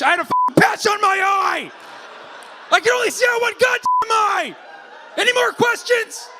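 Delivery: very high, loud indignant shouting; mock angry outburst. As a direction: yell it like an exasperated patient, hammed up. Acts out the guest's angry outburst.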